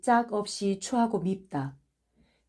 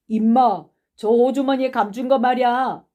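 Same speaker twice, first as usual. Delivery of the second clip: loud, higher accusing shout; raised voice, pointed accusation; 4 semitones higher, 6 dB louder than usual. A narrator's reading. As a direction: yell at a boy you've caught. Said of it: "인환이가 소리를 친다".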